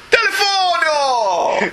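slow, stretched Italian "Telefono!" shouted high; hammed-up Italian accent. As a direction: hold out every syllable of Telefono like a cartoon Italian cop.

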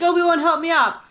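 mock plea. Anakin asking Obi-Wan to back him up, imitated plea. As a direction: lift it high and loud, appealing for backup.

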